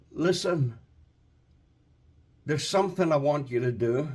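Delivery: soft, low voice of the Father calling; a quiet call from God, lightly voiced; hushed, intimate voice of God; God's gentle voice. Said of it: "the Holy Father ... said to him".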